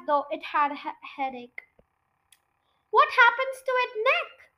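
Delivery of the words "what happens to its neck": high startled question; wife alarmed at the stretching neck; alarmed cry. A narrator's reading. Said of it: "cried Missus Cranky said".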